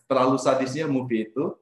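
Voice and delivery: ordinary talk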